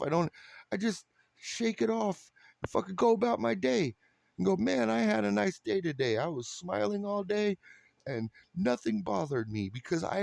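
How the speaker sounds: high mock epiphany. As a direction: play the newly enlightened fool, squeaky and giddy.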